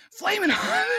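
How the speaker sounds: high, loud laughing "Flamin' hot!"; excited shout. Pretend. Loud repeat of phrase.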